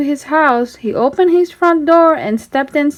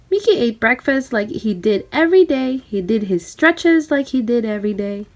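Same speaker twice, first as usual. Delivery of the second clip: usual speaking voice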